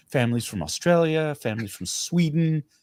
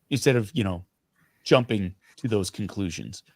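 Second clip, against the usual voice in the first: ordinary delivery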